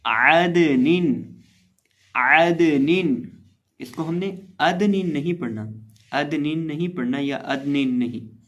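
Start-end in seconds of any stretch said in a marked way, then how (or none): 0.0-3.1 عدنن with ع from mid-throat and a slow drawn-out ending; correct pronunciation. Shown as a demonstration. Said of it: "تو اس کو پڑھیں گے".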